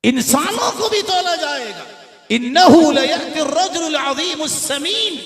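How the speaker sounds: high, loud, ringing sermon cry; impassioned high-pitched preacher; full-force preaching. Real, not acted. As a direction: proclaim it to the whole congregation at the top of your voice.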